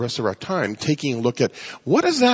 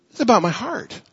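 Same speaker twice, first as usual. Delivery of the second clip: higher pitched insistence; 7 semitones higher than usual. Shown as a demonstration. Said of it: "repeated phrase, raised".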